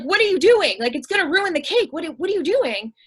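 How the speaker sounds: high, loud mock panic of a small boy; panicked boy. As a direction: sound alarmed, as if the cake is in danger. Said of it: "this little boy panicked".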